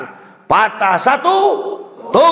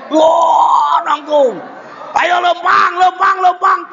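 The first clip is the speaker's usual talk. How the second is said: excited cheering; 10 semitones higher, slower than usual. Retold or imitated. Parents cheering baby to walk.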